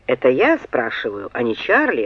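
low, restrained annoyance; annoyed. A narrator's reading. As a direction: pitch drops into mild irritation. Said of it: "рассердился Малькольм". (негромкое раздражение, низким голосом)

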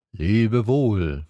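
drawn, even farewell from a fox; fox character. A narrator's reading. Fox answers the prince's goodbye, in a level voice. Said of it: "sagte der Fuchs".

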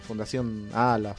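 usual speaking voice

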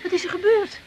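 high, pressing, frightened questions; frantic daughter begging her mother to speak; alarmed panic. Acted. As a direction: demand the news in rising panic. Alarmed by bad news of father.

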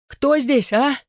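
high, challenging call; crying out. A narrator's reading. Trapped man demands who is there, acted high shout. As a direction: call out high at the unseen thing. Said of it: "выкрикнул он, выгибаясь дугой".

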